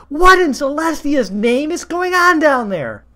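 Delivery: loud, high, demanding call; stern mother calling. A narrator's reading. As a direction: shout down the stairs at the child. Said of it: "called a stern voice from upstairs".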